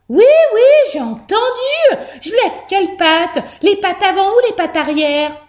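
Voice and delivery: very high little mouse voice; impatient mouse. A narrator's reading. Mouse told twice to raise paws, high and impatient. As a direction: squeal it back, high and exasperated. Mouse answers the repeated order.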